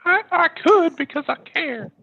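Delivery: high sweet falsetto. Acted. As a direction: coo it back in falsetto. Tom answers in falsetto.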